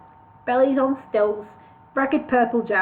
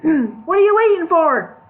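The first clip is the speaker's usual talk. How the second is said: slow, loud, high demand; impatient shout; 6 semitones higher, 6 dB louder than usual. Acted.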